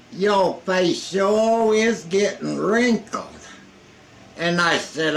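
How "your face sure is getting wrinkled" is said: higher, slow teasing buddy voice; imitating Rusty. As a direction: poke fun at his wrinkles in a higher voice.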